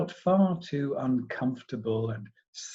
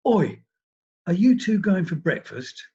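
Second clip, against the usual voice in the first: loud, higher bossy London voice; peremptory London accent; 6 semitones higher, 6 dB louder than usual. A narrator's reading. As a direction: call over the seat in a brash London accent. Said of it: "a peremptory London accent".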